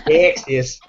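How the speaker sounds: put-on half-hearted Texas cowboy accent; badly faked Texan. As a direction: have a weak go at a cowboy twang. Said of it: "I can't even do, like, a cowboy accent".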